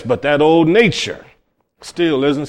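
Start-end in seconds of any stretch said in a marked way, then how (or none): none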